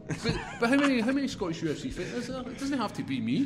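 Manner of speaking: high, laughing, incredulous; laughing protest. Real, not acted. Laughing defence of himself.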